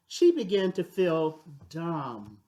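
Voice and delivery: plain reading voice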